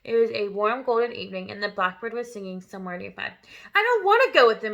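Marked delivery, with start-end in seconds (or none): 3.7-4.7 loud high shout. A narrator's reading. Matilda refusing to leave, loud shout. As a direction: shout it defiantly. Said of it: "Matilda shouted".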